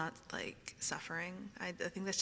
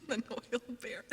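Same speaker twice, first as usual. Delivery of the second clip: higher voice breaking into giggles; giggly; 5 semitones higher than usual. Real, not acted. Streamer amused by a viewer's lavish gifts, giggly. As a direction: say it through real giggles. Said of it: "I am very giggly".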